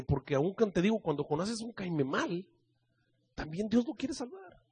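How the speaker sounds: ordinary talk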